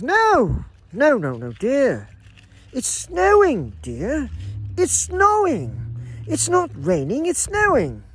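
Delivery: high, slow, insistent wifely voice; drawn-out high "snowing" from a put-on wife; mock wife correcting husband. Wife at the window insists to her husband it's snowing, in a high drawn mock voice. Wife in retold Russian joke.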